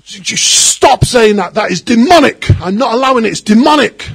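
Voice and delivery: retold shout at wife. Husband shouting at his wife in an argument, loud and angry. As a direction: bellow it as if rebuking a demon. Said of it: "he shouted at his wife".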